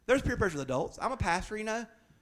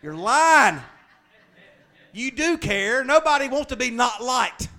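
loud preaching call-out; 9 semitones higher, 10 dB louder than usual. Real, not acted. Preacher calls out congregation.

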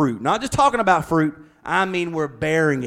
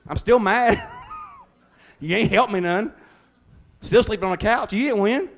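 lightly voiced grumpy husband, a bit higher; mock aggrieved spouse; 6 semitones higher than usual. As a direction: grumble like the loser of the fight, half joking. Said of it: "voicing an arguing spouse".

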